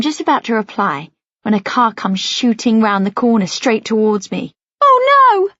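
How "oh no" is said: sudden high, loud cry of fear; frightened cry. A narrator's reading. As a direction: shriek it as a car rushes at you. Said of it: "I cry".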